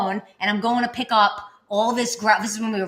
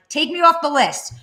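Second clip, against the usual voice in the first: higher, louder, firm demand; retold anger; 7 semitones higher, 5 dB louder than usual. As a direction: cut the caller off angrily. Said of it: "Like, I'm getting angry with him now".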